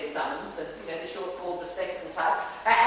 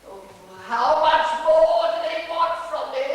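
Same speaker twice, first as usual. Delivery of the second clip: loud, high, slow theatrical lament; crying out; 8 semitones higher, 9 dB louder, slower than usual. Acted. Dresser re-enacting exhausted Sir, crying out. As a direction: wail it out grandly to the heavens. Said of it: "he cried out".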